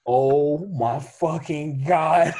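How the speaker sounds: loud, drawn-out disbelief; slow, loud, aghast reaction; retold shock. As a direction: stretch every word in mock horror. Retelling his shocked reaction to stranger.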